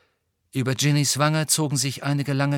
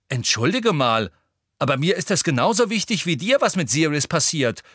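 louder, quick, indignant pushback; determined protest; 5 semitones higher, 5 dB louder than usual. A narrator's reading. Ginny insisting she cares about Sirius, determined protest. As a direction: stand your ground forcefully. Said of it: "schob entschlossen den Unterkiefer vor".

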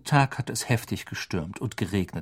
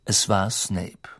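ordinary delivery, nothing marked

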